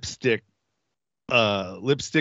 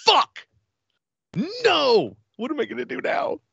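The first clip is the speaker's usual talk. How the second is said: mock dismay. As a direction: squeal in hammed-up panic that the high is fading. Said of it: "I'm like".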